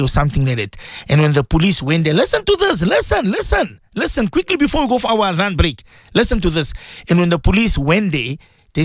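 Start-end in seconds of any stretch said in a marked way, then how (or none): 2.1-6.7 much higher, urgent call to the listeners. Real, not acted. Radio host urging his listeners to listen, pitched high and urgent. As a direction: grab the audience, listen listen.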